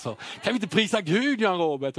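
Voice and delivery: high, eager call to praise; overexcited praise cry; excited. Retold or imitated. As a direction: push the joy over the top. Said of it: "lägg av, tagga ner".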